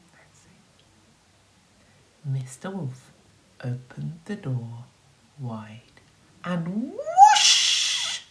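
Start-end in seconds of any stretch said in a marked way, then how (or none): 0.1-0.6 hushed. A narrator's reading. Storyteller hushing suspense. 6.4-8.2 very high, loud whoosh. A narrator's reading. The neighbours rush past the wolf, very high and loud.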